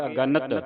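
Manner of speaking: ਅਗਣਤ as a-ga-nat, three syllables; full middle vowel. Shown as a demonstration. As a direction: sound the short a after ਗ in ਅਗਣਤ. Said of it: "ਐੜਾ ਸੰਬੰਧਕ ਲਾ ਕੇ".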